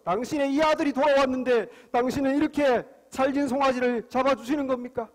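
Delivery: put-on angry older son. Older son challenging father over the fatted calf, angry. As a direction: act out an offended son's protest. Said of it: "화가 잔뜩 나서".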